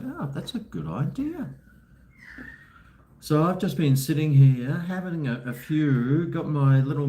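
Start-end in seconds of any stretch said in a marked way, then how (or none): 0.0-1.5 high quoting voice. Retold or imitated. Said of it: "So I'm like".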